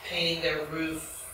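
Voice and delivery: usual speaking voice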